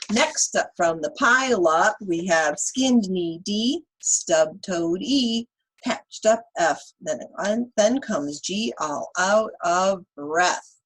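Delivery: put-on lively read-aloud for kids; reading to children. Teacher reading Chicka Chicka Boom Boom to children, lively. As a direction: read the rhyme to the class with bounce.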